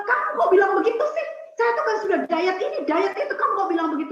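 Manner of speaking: high, loud indignant protest; angry auntie. Retold or imitated. Speaker retells auntie confronting her about the diet, angry. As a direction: imitate an offended woman scolding back. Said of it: "Dia marah, dia bilang".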